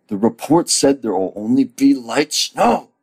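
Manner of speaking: loud, high protest climbing at the end; mock protest. [name] argues with Emma about the weather, loud protest.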